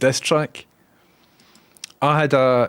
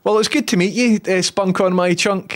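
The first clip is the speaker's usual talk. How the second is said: overly polite, pitched up and a touch louder; fake-cheerful nice to meet you; mock polite greeting; 8 semitones higher, 3 dB louder than usual. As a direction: greet him with exaggerated politeness. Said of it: "I'm like".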